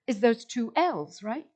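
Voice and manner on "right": ordinary talk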